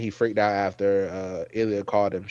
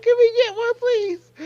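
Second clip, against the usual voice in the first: much higher squeaky begging; whiny plea; 4 dB louder, slower than usual. Retold or imitated. Begging the show for one happy ending, squeaky high whine. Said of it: "I was like".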